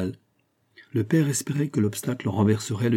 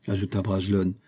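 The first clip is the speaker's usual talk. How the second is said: plain reading voice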